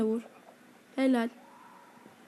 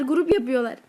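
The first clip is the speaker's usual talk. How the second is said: ordinary talk, nothing marked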